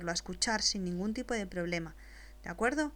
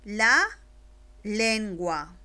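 slow dictation; 6 dB louder, much slower than usual. Shown as a demonstration. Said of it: "después te la dicto".

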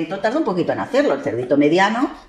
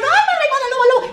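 very high, louder squealing; mock terrified piglets; 5 dB louder than usual. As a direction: squeal in playful panic. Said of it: "Y los cerditos dijeron".

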